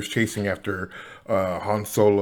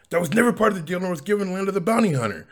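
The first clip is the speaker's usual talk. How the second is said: mock angry yell; 9 semitones higher, faster than usual. Lando protesting to Darth Vader, higher and indignant. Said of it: "He yells at him".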